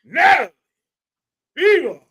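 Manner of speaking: a grand showman's announcement, climbing high; ringmaster. Pretend. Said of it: "let me give her one".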